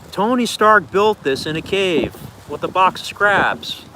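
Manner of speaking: slow, high-pitched villain outburst; Obadiah Stane. Streamer quoting an Iron Man villain, hammed-up slow outburst. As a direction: draw out each word in mock outrage. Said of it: "Oh no, he says".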